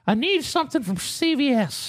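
put-on high, slow old man voice; high-pitched elderly impression, dragging the words; old man neighbor. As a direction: imitate an old man drawing out a request. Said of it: "He goes".

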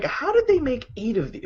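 high, hushed disbelief; incredulous wondering. Retold or imitated. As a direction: ask it amazed, half to yourself. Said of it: "I'm like".

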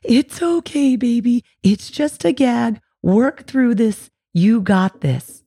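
put-on high, slow soothing baby talk. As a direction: coo calmly to a scared baby. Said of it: "same thing when your baby is gagging".

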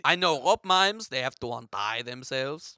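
put-on French-accented mime. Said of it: "my French accent isn't that good".

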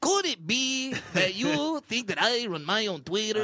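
high-pitched mock Canadian voice; exaggerated Canadian accent. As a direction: overdo the Canadian accent until it lands. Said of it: "Oh, that's Canadian sound".

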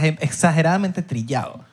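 ordinary talk

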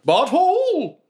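high, drawn-out cry of a name; villain calling out. Acted. Forsaken turning to call to a dragged-off ally, high cry. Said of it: "you see the Forsaken turning in direction and say".

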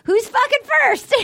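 high, excited parody of him; eager Bachelor. Retold or imitated. Mocking the Bachelor's greeting to the women, high mock eagerness.

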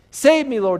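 high, loud plea for rescue; desperate shout. Acted. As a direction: shout for help as if sinking. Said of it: "he shouted".

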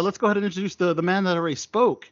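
usual speaking voice

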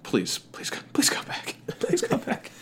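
whispered begging; pleading whisper. Real, not acted. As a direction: softly plead him to come back. Begging Tillman to return.

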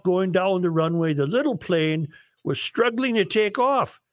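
bad singsong Norwegian for the joke; worst Norwegian voice. Host telling Sven and Ole joke, worst Norwegian accent. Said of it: "use your worst Norwegian voice".

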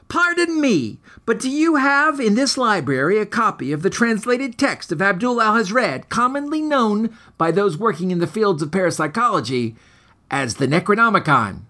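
put-on a boy asking the librarian with grand formality; high, pompous kid voice; prim boy voice. A narrator's reading.

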